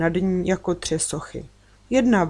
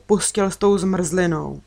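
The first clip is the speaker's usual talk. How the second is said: ordinary delivery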